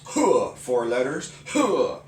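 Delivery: grido secco di karate; karate grunt. Shown as a demonstration. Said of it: "they'll go".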